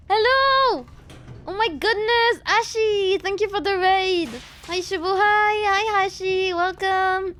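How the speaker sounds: high bubbly greeting voice; chirpy, excited welcome to raiders; cute anime streamer. Real, not acted.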